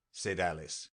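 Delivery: ordinary delivery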